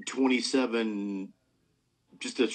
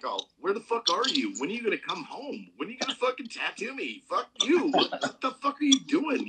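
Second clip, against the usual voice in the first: mock angry clients; 9 semitones higher than usual. Host imitating impatient clients with a high-pitched mock outrage. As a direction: squeal like a pushy client demanding a tattoo. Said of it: "they're like".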